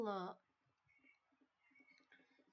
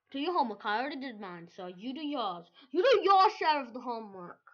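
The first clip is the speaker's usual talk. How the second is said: mock bossy lecture; 12 dB louder than usual. Mock-lecturing viewers about homework.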